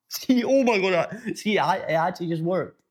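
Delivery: high, amazed, through real laughter; delighted at being caught laughing; real laughing surprise. Real, not acted. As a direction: laugh out the words, pitch jumping up then settling. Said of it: "And a real laugh came out".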